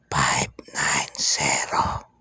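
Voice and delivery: slow hushed whisper, barely voiced. Shown as a demonstration. Vlogger whispering the lotto tip to viewers, slow and hushed. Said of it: "Bubulong natin sa inyo".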